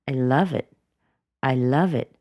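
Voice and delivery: "I love it" with LOVE stressed, falling after; no upward rise. Shown as a demonstration. Answering do you enjoy your work, no upward rise. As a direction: land "I love it" as a finished statement. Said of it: "there was no upward rise".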